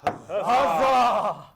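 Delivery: loud theatrical huzzah; hearty cheer. Acted. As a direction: cheer it out like a courtier. Cheering the baroness.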